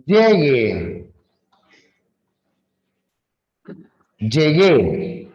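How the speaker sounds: each word set apart by long pauses; slow word list. Shown as a demonstration. Teacher reading example words aloud, slowly. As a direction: read the words out one by one. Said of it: "colocamos varias palabras".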